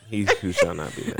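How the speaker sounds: ordinary talk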